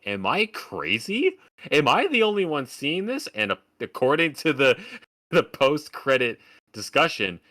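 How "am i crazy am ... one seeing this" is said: incredulous. Retold or imitated. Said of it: "I looked around to my audience like".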